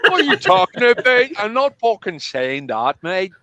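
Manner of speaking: indignant retort in exaggerated Northern Irish; broad Northern Irish. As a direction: mimic an annoyed Northern Irish friend. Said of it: "he has the broadest Northern Irish accent".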